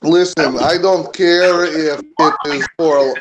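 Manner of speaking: put-on Russian-accented worker's protest. Worker argues with the guard, Russian accent. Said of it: "Using my Russian accent".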